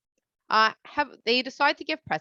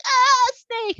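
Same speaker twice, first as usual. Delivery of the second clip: mock scream; 8 dB louder than usual. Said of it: "They'll be like".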